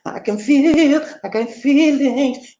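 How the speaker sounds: high, slow, mocking sob-story voice; sarcastic high whine over feelings. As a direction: drag it out like a mock weepy complainer. Mocking people who go by feelings.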